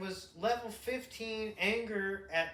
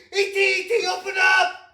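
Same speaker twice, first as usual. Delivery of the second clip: high, loud calling out; hammed-up shouting; mock yell; 13 semitones higher, 15 dB louder than usual. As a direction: bang on the door and yell. Mocking friend yelling at ET.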